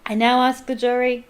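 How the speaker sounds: loud commanding shout; loud judge's command. Acted. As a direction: shout the call to the jury. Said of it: "shouted Mister Crouch".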